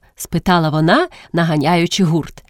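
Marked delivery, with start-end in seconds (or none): none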